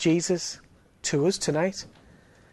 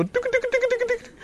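high trembling lip-wobble noise; little kid about to cry; lip wobbling before crying; 3 dB quieter than usual. Retold or imitated. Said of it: "the little lip begins to go".

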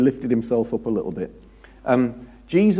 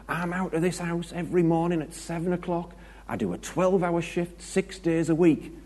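higher husband voice, a touch defensive; playing the hard-working husband justifying himself; mock husband; 7 semitones higher than usual. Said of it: "he goes on to say".

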